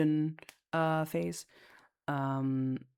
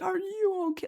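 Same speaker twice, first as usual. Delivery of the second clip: high-pitched, melodramatic imitation of concern; very dramatic. Mother fussing over her rescued kid, mock-dramatic. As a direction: mimic an overwrought mother, pitched way up. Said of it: "Like, very dramatic".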